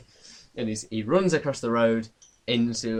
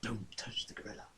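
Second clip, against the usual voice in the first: whisper; 2 semitones lower, 17 dB quieter than usual. Acted. Eerie whispered warning voice.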